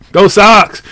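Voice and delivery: high loud team cheer. Real, not acted. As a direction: shout for the Red Sox.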